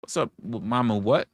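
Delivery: ordinary talk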